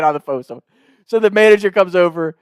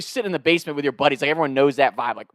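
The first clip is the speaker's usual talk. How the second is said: ordinary talk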